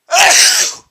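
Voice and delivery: loud explosive sneeze. Real, not acted. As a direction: let a big sneeze burst. Sneezing after announcing it.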